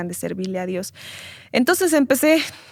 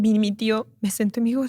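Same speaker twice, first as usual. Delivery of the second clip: ordinary talk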